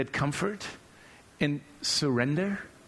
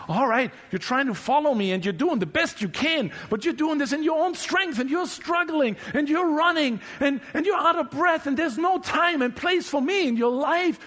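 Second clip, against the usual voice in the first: higher, louder, hurried exasperation with a laugh; exasperated God; 9 semitones higher, 4 dB louder than usual. Acted. God scolding a busy believer, exasperated and rising. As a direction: pile it on like a frazzled rush.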